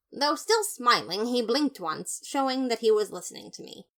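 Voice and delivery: ordinary delivery, nothing marked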